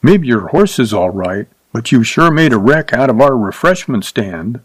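usual speaking voice

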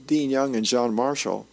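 ordinary talk, nothing marked

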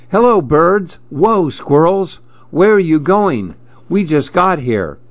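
put-on high-pitched child voice greeting the animals; high, eager storybook boy; excited little boy. A narrator's reading. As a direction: call out like a wide-eyed kid. Voices excited boy in picture book.

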